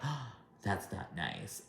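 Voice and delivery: soft, slow mock-shocked reply; hushed dismay. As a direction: drop to a small, hurt murmur in imitation. Retells Jackie's gasped mild objection.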